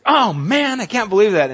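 high, loud exasperated cry; sudden loud exasperated complaint; frustrated outburst. Shown as a demonstration. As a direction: blurt it out in frustration at the printer. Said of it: "I just have this outburst".

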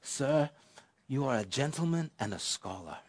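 soft, lightly imitated Welsh accent; a hint of an elderly Welsh gentleman, spoken softly. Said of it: "beautiful accent from this gentleman".

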